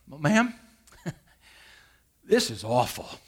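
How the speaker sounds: sigh, then admit it gently; reluctant honest confession. Retold or imitated. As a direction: break the bad news with a sigh and a small laugh. Pastor finally tells hostess truth.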